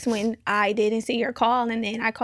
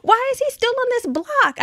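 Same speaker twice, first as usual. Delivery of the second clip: imitating her frightened friend, high and louder; panicked friend; 13 semitones higher, 4 dB louder than usual. Mocking her friend's panic over the man on the block, high-pitched. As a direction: squeak it out in alarm like the scared friend. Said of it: "And Germany was like".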